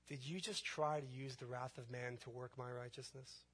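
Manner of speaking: lightly voiced gentle reproof, very soft; Holy Spirit. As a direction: softly question him with long pauses, lightly in role. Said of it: "And then the Holy Spirit comes".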